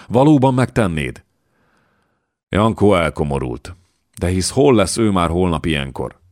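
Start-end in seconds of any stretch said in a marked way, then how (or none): 0.1-1.2 higher, louder eager question. A narrator's reading. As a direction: ask it keenly, pitch jumping up. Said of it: "lelkesen felkönyökölt". (lelkesen, izgatottan)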